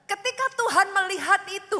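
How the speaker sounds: ordinary talk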